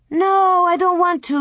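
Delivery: high-pitched, insistent refusal; balking boy. A narrator's reading. Louie refuses to ride the sled first, high protest. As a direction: dig in like a reluctant child.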